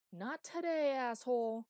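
loud, high snarling shout; furious defiant yell. Acted. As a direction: spit it out in defiance. Defiant yell at choking plant.

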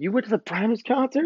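high, quick incredulous question; mock-excited, fast and high; imitated amazement. Impressed coworkers voiced in story.

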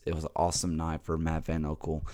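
ordinary delivery

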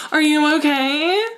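high, loud, drawn-out checking-in; annoying concerned ex. Acted. Pestering an ex after a breakup, cloyingly overconcerned. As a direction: overdo the concern, stretching it out. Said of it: "that annoying person that would be like".

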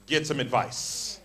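ordinary talk

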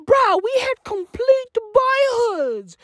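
normal talking voice

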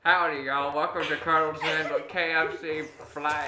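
Southern pilot greeting, lightly put on, laughing; southern accent. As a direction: welcome them aboard in a mild Southern twang. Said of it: "stop the southern accent".

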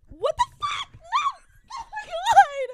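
real panicked high scream; screaming for life. Real, not acted. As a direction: shriek as if being attacked. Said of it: "you literally scream for your life".

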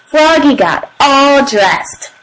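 ordinary delivery

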